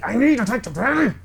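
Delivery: high, rushed, strained outburst; failed whisper. Acted. Max failing to whisper to Ioane, high frantic voice. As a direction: whisper breaking into a high urgent squeal. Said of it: "he can't".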